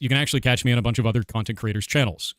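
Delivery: ordinary talk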